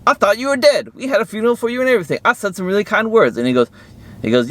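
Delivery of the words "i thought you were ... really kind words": much higher voice, rushing the middle line; lightly voiced brother, shocked to see him alive; astonished brother. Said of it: "and he's like".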